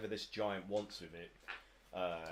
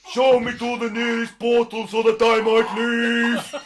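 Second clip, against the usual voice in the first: loud, high giant voice breaking into a laugh; polite giant; 19 dB louder, slower than usual. Acted. Giant asking the pixie for a portal, loud and laughing. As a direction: ask grandly while laughing. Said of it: "He's like".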